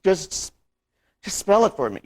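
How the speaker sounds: loud, high-pitched insistent plea; agitated plea. Acted. Acting out distress at the decision.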